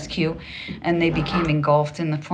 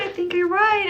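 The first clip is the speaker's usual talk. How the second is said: much higher, lilting; high singsong. Real, not acted. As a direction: stretch it up high and musical. Repeated agreement, sung high.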